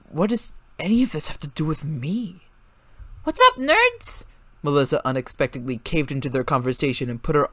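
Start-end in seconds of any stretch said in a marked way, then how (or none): 3.2-4.2 cheeky greeting. A narrator's reading. Melissa barging in cheerfully.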